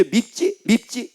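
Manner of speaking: high, quick little prods; tempting devil. Acted. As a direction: needle the listener like an acted tempter. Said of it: "마귀가 탁 와가지고 얘기합니다".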